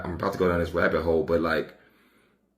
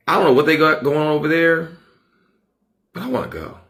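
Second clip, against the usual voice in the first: excited envy; 10 semitones higher, 8 dB louder than usual. Real, not acted. Envious of Scotland.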